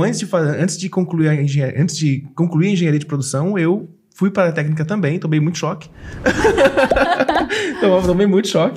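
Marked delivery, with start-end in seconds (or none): 5.9-7.7 high bursting laugh. Real, not acted. Host remembering shocks as a technician, high laugh.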